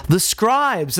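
ordinary delivery

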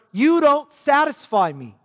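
high, loud cutting rejection; scornful husband brushing off his wife; contemptuous. Retold or imitated. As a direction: spit it at her.

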